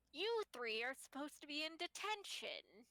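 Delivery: pirate scolding. Acted. Pirate librarian scolding students.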